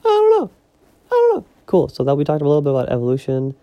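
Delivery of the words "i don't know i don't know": very high-pitched, loud, playful squeak; silly squeaky voice. Pretend. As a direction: jump way up into a mock falsetto. Breaking monotone with silly voice.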